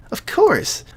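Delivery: put-on very high, bright girl's cry; Sayori voice. Sayori answering her friend, very high and happy. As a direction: squeak it out happily.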